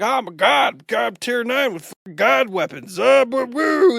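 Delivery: hammed-up hype ending in silly high noises; Matt. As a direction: imitate an overexcited buddy bragging. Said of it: "my buddy Matt's like".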